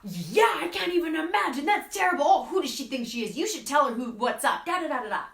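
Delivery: high, loud, egging-on agreement; hammed-up supportive friend taking sides; mock outraged friend. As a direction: gasp along with your friend's outrage. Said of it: "basically saying".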